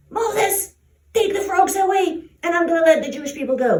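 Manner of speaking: high, loud exasperated plea; harried king demanding relief; annoyed Pharaoh. A narrator's reading. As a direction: call out urgently, fed up. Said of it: "So Pharaoh got so annoyed".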